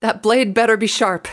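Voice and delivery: high, loud, daring retort; defiant challenge. Acted. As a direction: dare the armed captain in a high voice. Rainbow defies the armed captain.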